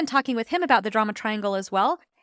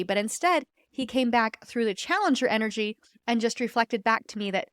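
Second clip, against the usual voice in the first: usual speaking voice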